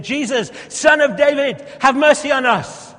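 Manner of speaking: loud, high cry; crowd shouting. Acted. Crowd jostling for position, shouting loud to Jesus.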